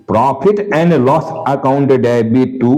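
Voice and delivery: slow dictation. Shown as a demonstration. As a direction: read the entry out slowly for copying. Dictating the net entry.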